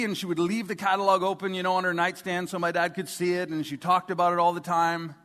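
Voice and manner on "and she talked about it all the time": normal talking voice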